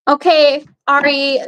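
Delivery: ordinary delivery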